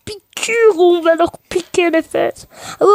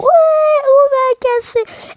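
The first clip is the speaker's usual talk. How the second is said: excité; 11 semitones higher, slower than usual. Real, not acted. Excité pendant l'attaque.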